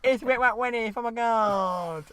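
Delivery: put-on cheery girl voice. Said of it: "she's gonna put on a good accent".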